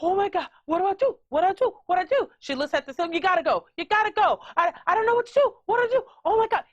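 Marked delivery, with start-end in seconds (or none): 0.0-2.3 higher-pitched mock panic, gasping first; frantic repeated pleading, acted out; panicking woman. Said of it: "So the woman's like". 3.1-6.6 high, frantic mock pleading; helpless woman shooing, voice pitched up; panicking woman. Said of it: "It is repeating the same thing over and over again".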